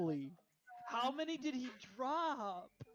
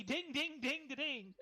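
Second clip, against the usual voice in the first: sung chime; 5 semitones higher, slower than usual. Real, not acted. Imitating level up chime.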